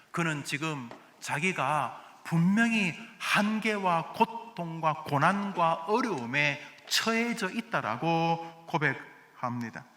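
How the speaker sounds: normal talking voice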